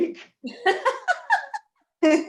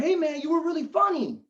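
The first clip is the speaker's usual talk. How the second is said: higher-pitched eager fan; higher voice, greet the comic as a fan; 7 semitones higher than usual. Acted.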